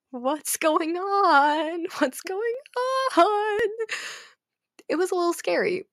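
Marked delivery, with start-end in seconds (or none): 0.1-3.6 slow, higher drawn-out what's going on; mock confusion. Teen alone in lockdown wondering, drawn-out confused voice. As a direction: stretch it out in bewildered worry. Quoting own pandemic confusion.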